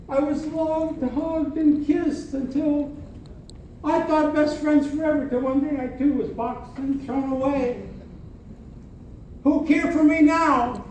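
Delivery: high, soft little teddy voice; sad little teddy. Acted. Little teddy mourning being thrown away, high and soft.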